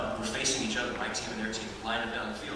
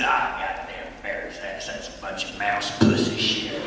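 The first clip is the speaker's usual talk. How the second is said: slow, low granddad grumble; 4 semitones lower, slower than usual. Retold or imitated. Granddad cussing on the porch to his grandchild, slow granddad grumble. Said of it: "He'd say".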